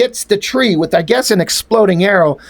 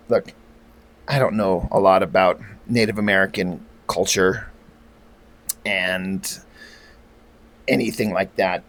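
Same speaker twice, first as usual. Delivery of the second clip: hushed aside; 12 semitones lower, 8 dB quieter than usual. Real, not acted. Embarrassed aside to viewers.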